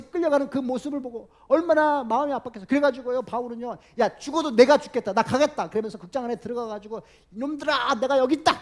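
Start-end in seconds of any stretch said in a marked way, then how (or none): 4.0-5.7 loud, resolute cry of sacrifice; determined outcry. Retold or imitated. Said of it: "이렇게 하려고 막 나섰어요". 7.3-8.5 defiant call. Retold or imitated. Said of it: "뭐 이렇게".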